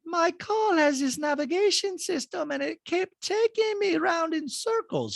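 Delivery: very high, slow, airy socialite; bad Diana impression.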